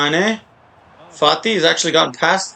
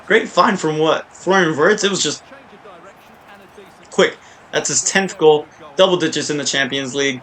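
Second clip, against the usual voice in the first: usual speaking voice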